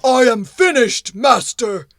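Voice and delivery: loud, heavy stone golem. Acted. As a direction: boom it out like a stone giant. Golem reports to master.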